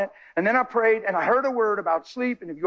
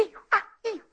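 imitating excited woman; 12 semitones higher, 5 dB quieter, much faster than usual. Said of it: "She's telling me".

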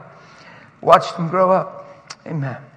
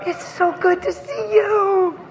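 high, drawn-out fond greeting; affectionate gushing; 11 semitones higher, slower than usual. Real, not acted. Preacher hugging a friend's child, high slow fond coo. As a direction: slowly gush into a hug.

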